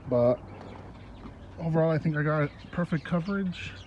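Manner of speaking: usual speaking voice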